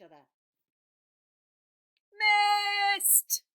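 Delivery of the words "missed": very loud, much higher shout; crowd yell. Acted. Crowd yells as the fighters miss, loud shout. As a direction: yell it from the stands. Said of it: "yelled the crowd".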